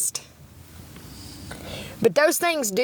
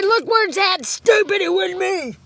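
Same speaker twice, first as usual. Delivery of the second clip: angry retort; 8 semitones higher, 4 dB louder than usual. Retold or imitated. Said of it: "He said".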